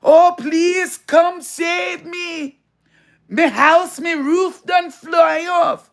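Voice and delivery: slow, high, shouted mock Jamaican accent begging for rescue; mock Jamaican plea. Mocking Jamaicans begging for help after the storm, in a shouted Jamaican accent. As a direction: ham up a Jamaican voice crying out for help. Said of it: "I'm watching them screaming and begging for help".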